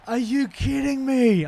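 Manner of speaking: high, drawn-out shout of disbelief. Real, not acted. Gamer to the game after a failed run, outraged shout. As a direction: yell it slowly, really outraged. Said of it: "I'm sorry for shouting at you".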